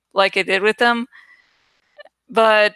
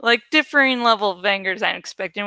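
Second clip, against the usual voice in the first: usual speaking voice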